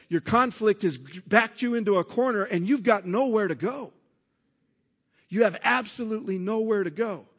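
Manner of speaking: loud, driving sermon build; preaching at full force. Acted. Pastor urging the congregation, in a loud, driving preaching voice. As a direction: preach it out to the congregation, pushing hard.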